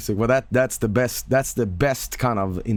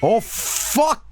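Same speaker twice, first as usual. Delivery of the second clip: high, sharp startled outburst; sudden real surprise; 10 semitones higher than usual. Real, not acted. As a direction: caught off guard by the music, blurting it out.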